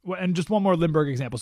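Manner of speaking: ordinary talk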